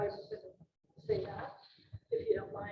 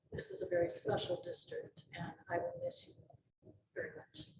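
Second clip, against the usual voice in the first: tearful goodbye, fading softer; low voice, close to real tears; choked up; 13 semitones lower, 6 dB quieter than usual. Real, not acted. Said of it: "just in case I got choked".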